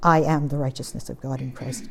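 low, soft, composed declaration; very quiet, calm. Shown as a demonstration. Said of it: "very quietly, very calmly say".

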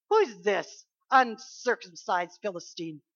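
loud, slow, fearless challenge; a hint of a brash young fighter; loud scornful question; bold defiance. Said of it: "that's the attitude".

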